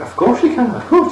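high, sing-song mock cheerfulness; chirpy and cheerful. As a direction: imitate an overly bright, eager teacher. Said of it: "She's all, like, chirpy and cheerful".

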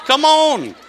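loud, high boast; proud come on. Pretend. Pastor boasting to the congregation about his jeans, loud. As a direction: sell it to the crowd.

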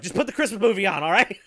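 hurried, high, loud and half-laughing; mock exasperation. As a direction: snap it back quickly through a laugh. Acts himself snapping back, laughing.

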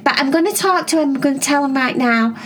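put-on excited kid asking her sisters questions; Cherry voice.

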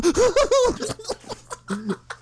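very high, helpless long cackle; dying laughing. Retold or imitated. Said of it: "dying laughing".

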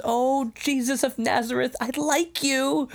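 very high-pitched, delighted imitation, drawn out at the end; excited. Host acts out Quintus talking to Jesus, so excited. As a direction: gush it in a high mock-excited voice. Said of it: "Quintus is just so excited".

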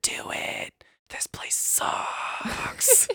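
bleak whisper, words stretched out; sad-boy whisper. Acted. Narrator voicing Britain's sad-boy vibes, bleak whisper. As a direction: whisper it like a gloomy mock voice of despair. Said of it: "the sad boy kill yourself vibes that all of Britain has".

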